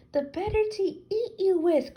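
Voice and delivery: high, loud, snarling pounce line; spring the last words at her like a predator; wolf cries out. A narrator's reading. Wolf in grandmother's bed pouncing on the girl, loud high cry. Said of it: "cried the wolf".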